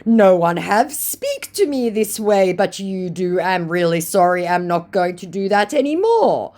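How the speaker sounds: flustered broken-English pleading; panicky scammer. Retold or imitated. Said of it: "said my panicky scammer".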